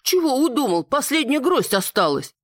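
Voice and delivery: high-pitched cook shooing an animal; mock scolding. A narrator's reading. Cook shoos animal off the grapes.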